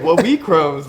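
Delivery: giggling mock disbelief at the cop; laughing innocence. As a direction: laugh it off like you know nothing. Playing dumb with the trooper.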